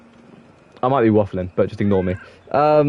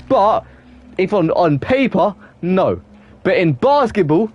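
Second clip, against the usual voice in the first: normal talking voice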